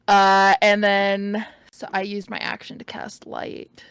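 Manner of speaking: ordinary delivery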